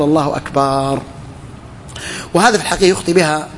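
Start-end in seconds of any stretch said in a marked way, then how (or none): 0.0-1.0 الله أكبر ending in a long, level "baaar"; slow takbir, last syllable of أكبر stretched out; lengthened ba. Shown as a demonstration. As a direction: hold the vowel after the b in أكبر. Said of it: "فإذا قال أكبار".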